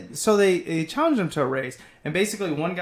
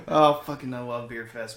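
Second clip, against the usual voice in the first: ordinary talk, nothing marked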